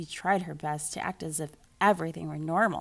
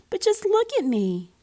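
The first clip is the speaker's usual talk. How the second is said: upset cry; 12 semitones higher, 8 dB louder than usual. Acted. Camilla distressed showing her stripes.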